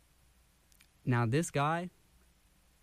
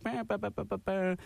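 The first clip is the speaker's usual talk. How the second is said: imitated air horn; 9 semitones higher than usual. Radio host faking missing air horns for listeners, high mock blasts. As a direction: voice the air horns he forgot, bah bah. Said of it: "I don't have my air horns".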